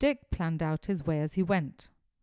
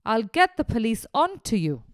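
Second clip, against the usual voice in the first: angry threat; 5 semitones higher, 8 dB louder than usual. Acted. Farmer yelling threat.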